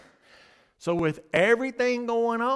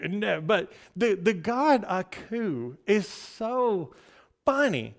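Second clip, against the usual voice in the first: ordinary talk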